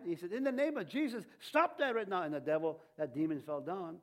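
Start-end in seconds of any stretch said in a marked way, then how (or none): 0.3-2.2 raised, louder commanding rebuke; angry rebuke. Retold or imitated. Minister rebuking a demon spirit that blocks Jesus, angry raised command. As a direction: retell the rebuke with real heat, pitch up. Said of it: "and he got mad".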